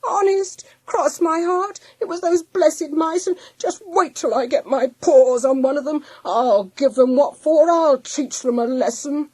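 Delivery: high, loud indignant whine; protesting dog. A narrator's reading. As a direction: protest innocence, then rise to blustering threats. Dog protesting innocence, threatening mice.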